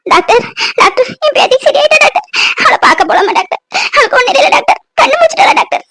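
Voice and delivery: put-on old grandmother, high and pleading, begging for news; a desperate grandmother clutching at hope; anxious grandmother. Grandmother asks the doctor if her granddaughter is awake, pleading.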